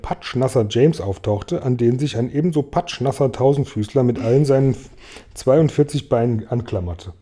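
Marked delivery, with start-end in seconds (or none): none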